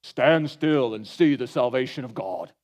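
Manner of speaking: slow, low, lofty prophet tone; hammed-up sacred pronouncement, drawn out; mock holy voice. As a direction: intone it like a solemn movie Moses. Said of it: "And somehow he said it like this".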